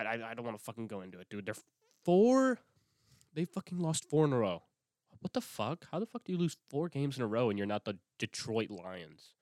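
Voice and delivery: ordinary talk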